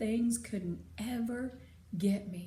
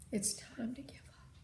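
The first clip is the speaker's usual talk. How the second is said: softer, whispered resignation; 6 dB quieter than usual. Acted. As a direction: gently give in. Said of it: "in a real soft voice".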